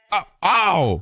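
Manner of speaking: high startled yelps. Real, not acted. Hit from behind in the game, a high startled yelp. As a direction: jump at the hit and cry out high.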